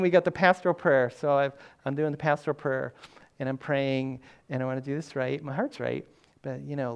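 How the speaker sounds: ordinary delivery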